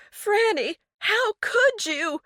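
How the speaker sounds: high, drawn-out anguished wail. A narrator's reading. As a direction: wail it in despair. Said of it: "Eleanor wailed".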